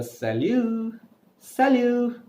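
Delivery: "Salut" said high and drawn, French u; French greeting demo. Shown as a demonstration. Teacher showing learners a French hello, careful pronunciation. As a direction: model "salut" clearly for learners. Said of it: "So you can say".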